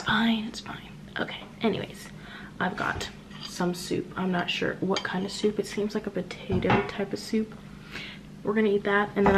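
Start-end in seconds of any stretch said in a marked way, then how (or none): 0.4-4.1 whispering. Real, not acted. Whispering in hotel at night.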